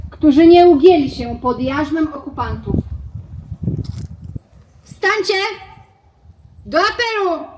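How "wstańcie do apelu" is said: high ringing summons; ceremonial call. Real, not acted. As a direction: call the fallen to rise.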